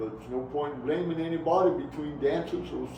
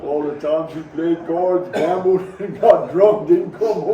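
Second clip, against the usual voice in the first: laughing while talking; 4 semitones higher, 10 dB louder than usual. Real, not acted.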